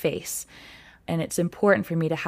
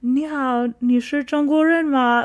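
higher, hesitant, nervous try at Chinese; shaky nervous Chinese; 8 semitones higher, slower than usual. Retold or imitated. Learner nervously greets old shop ladies, in hesitant higher Chinese. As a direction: ask timidly, like a shy learner. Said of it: "very weird and shaky".

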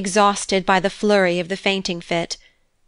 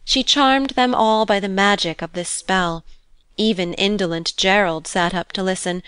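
usual speaking voice

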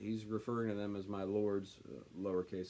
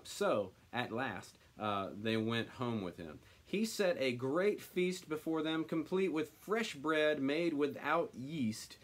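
normal talking voice

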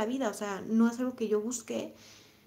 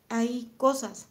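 normal talking voice

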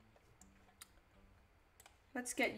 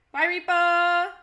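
slow, stretched, louder goodnight; drawn-out farewell; 4 semitones higher, 10 dB louder, much slower than usual. Acted. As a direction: drag the words out to a friend leaving. Saying goodbye to Reaper.

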